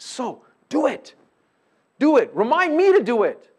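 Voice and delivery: loud, high push to act; rally them to do it; urging call. Real, not acted. Exhorting congregation.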